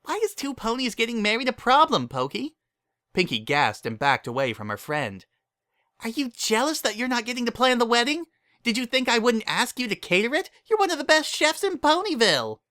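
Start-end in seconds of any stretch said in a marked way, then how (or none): 0.0-2.5 squeaky cheerful pony; high excited Pinkie voice; Pinkie's voice. A narrator's reading. 6.0-12.6 squeaky cheerful pony; high excited Pinkie voice; Pinkie's voice. A narrator's reading.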